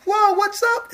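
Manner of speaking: high goofy casual dude voice; high mocking bro voice; mock Jesus voice. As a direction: play him as a laid-back surfer dude.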